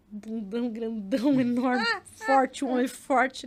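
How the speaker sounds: loud, slow words through laughter; laughing loudly. Real, not acted. Mother laughing as she describes her grown son, loud. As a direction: crack up while describing him. Laughing about son's big size.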